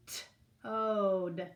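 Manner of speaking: T-ode split into slow separate sounds; segmented word. Shown as a demonstration. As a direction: break T-ode apart sound by sound. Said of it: "Which room did you hear D".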